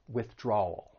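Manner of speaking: withdrawal with stressed DRAW into dark L; soft, low withdrawal ending in an "ul" sound. Shown as a demonstration. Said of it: "stress that second syllable and move".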